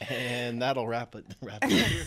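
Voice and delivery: radio host sign-off. Acted. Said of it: "radio host voice".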